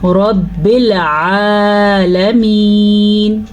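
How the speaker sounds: رب with a full, heavy ر, very slow. Shown as a demonstration. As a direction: make the ر of رب thick and full. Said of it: "را پہ زبر یا پیش ہو تو را پر پڑھی جاتی". (رب کی را کو پُر یعنی موٹا پڑھیں)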